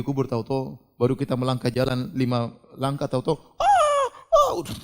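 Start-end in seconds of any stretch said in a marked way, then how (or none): 3.6-4.5 very high-pitched drawn-out "aah" wail; imitated grave screams. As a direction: mimic screams heard from a grave. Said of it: "di samping kita dengar".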